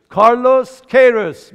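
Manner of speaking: کارلوس کیروس said slowly with an American accent, loud; American pronunciation. Real, not acted. Congregant answering the preacher, American accent. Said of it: "خیلی آمریکایی تلفظش کرد".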